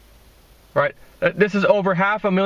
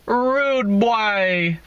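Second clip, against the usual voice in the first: mock Jamaican rude boy, higher pitch; lightly voiced Jamaican call of rude boy; Jamaican accent; 5 semitones higher than usual. Hailing Craig in a light mock Jamaican accent. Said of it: "That's how they say it in Jamaica".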